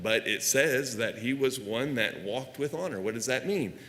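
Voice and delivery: ordinary talk, nothing marked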